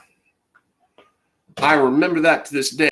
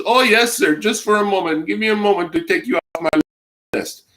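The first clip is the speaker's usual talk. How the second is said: put-on higher, brisk Indian accent; a polite call-centre agent with an Indian accent; 6 semitones higher than usual. Imitating the phone agent in an Indian accent. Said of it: "like an Indian from India".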